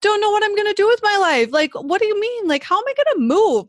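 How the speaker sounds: high mock wail; retold crying. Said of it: "crying. I text Amanda, and I'm like".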